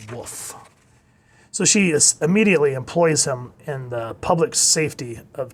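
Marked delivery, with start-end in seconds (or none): 0.1-0.5 hushed, submissive dog woof; obedient woof. Retold or imitated. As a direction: imitate an obedient dog, very softly. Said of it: "obediently saying".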